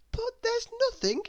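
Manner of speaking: very high, indignant surprise; baffled protest; exclaiming. A narrator's reading. Miss Giggles opens the empty box, surprised. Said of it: "she exclaimed".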